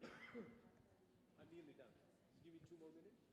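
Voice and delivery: muttered aside away from the mic; quiet aside. Real, not acted. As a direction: say it low to someone offstage.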